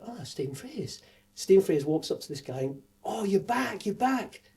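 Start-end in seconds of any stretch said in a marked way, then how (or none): 0.1-1.0 high, soft awed gasp of a name; hushed, high and starstruck. Retold or imitated. Starstruck at spotting famous director. 3.0-4.4 high, bright welcome; high, pleased "you're back"; delighted greeting. Retold or imitated. Greeting an old friend warmly.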